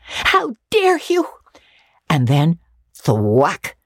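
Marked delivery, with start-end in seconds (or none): 0.0-1.2 put-on high, outraged girl's voice; girl furious at the boy who teased her; indignant cry. A narrator's reading. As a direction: hurl it in fury. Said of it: "she exclaimed passionately".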